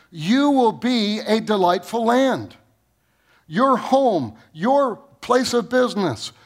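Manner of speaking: fervent pulpit preaching voice; impassioned preaching. Acted. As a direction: lift the congregation, preach it out. Preacher rising to full force.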